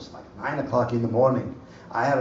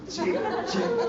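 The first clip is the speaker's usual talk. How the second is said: much higher, laughing mock preacher squeak; pitched-up laughing imitation of the preacher; imitating the pastor; 3 dB quieter than usual. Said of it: "how he was talking like this".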